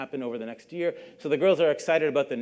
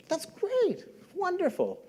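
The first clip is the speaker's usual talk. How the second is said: much higher, thrilled squeal of joy; giddy delight; 3 dB quieter, slower than usual. Retold or imitated. Yasmin hears she won secretary, much higher giddy delight. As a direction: gush like a thrilled teenager who just won.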